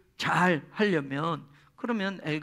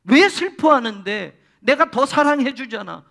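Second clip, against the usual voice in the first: louder, higher pleading husband; puzzled husband; 6 semitones higher, 8 dB louder than usual. Retold or imitated. Husband baffled by his crying wife, loud coaxing. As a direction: coax her, baffled by her tears. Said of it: "라고 말하는 거예요".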